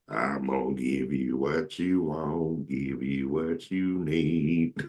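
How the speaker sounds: slow, drawn-out devotion leader; lightly voiced churchy leader stretching every word; a hint of a distracted church singer, slow. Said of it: "my church impressions".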